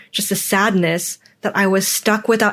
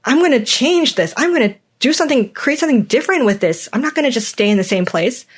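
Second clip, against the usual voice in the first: higher, fast defiant declaration, building energy; fired-up resolve; 4 semitones higher than usual. Retold or imitated. Fed up with her life, vowing change: high, fast, defiant. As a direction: spit out a fed-up vow to change things, quick and raised. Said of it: "be like".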